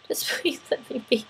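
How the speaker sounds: soft, near-whispered begging; hushed, worn-down little plea. Retold or imitated. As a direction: plead under your breath. Hushed plea at end of retold exchange.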